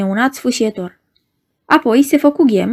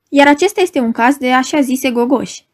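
plain reading voice

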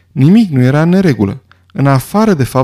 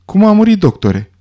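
impatient question; 7 semitones higher than usual. A narrator's reading. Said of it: "întrebă nerăbdător Lombar".

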